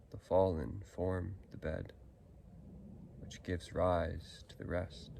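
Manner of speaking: slow, measured poetry reading with long pauses; poem recitation. Acted. Poet reads own workshop poem to listeners, slow measured recitation. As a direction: recite it line by line, giving each phrase space.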